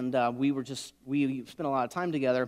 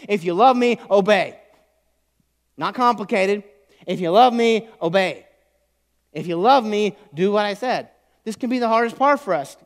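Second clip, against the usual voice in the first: high, loud, emphatic sermon voice; raised, forceful preacher's delivery; full-force preaching; 8 semitones higher, 11 dB louder than usual. Real, not acted. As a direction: preach it out to the whole room.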